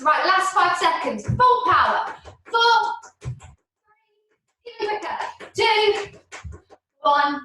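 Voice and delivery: high, loud coach calling out the last seconds; rising to a loud high countdown; shouted countdown. Real, not acted. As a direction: drive the class home with a big push. Fitness coach pushing the final sprint.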